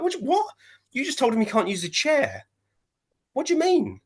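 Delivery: high, disbelieving what-what; incredulous outburst. Retold or imitated. Fan watching live, outraged the ref lets the chair go, high incredulous squeak. Indignant retold reaction to ref.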